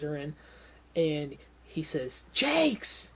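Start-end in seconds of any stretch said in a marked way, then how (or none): 2.3-3.1 high-pitched shout of a name; urgent call. Acted. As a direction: call out sharply for the boss. Watchman calls out urgently.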